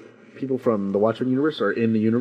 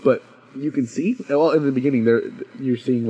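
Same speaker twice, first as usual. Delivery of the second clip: ordinary delivery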